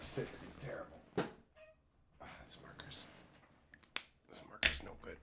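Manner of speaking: soft whispered grumble over the marker; whispered to self. Real, not acted. Streamer whispering to himself while writing with a bad marker. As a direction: breathe it under your breath, annoyed.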